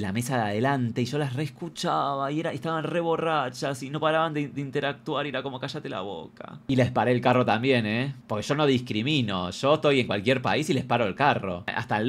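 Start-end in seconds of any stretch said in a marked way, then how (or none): 5.6-6.6 quiet retold rebuke. Comedian to drunk women up front, quietly fed up. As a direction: say it slowly and much softer.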